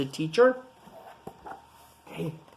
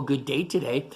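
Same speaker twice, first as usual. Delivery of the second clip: ordinary delivery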